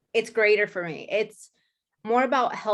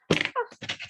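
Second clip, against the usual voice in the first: shaky sigh. Real, not acted. New agent choking up.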